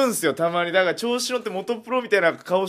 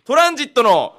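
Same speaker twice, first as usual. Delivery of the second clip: raised, louder corner announcement; hollered title call; 5 semitones higher, 5 dB louder than usual. Pretend. Host announces the radio segment, hollering. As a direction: build up to a yelled title. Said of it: "ちょっとがなりを忘れてました".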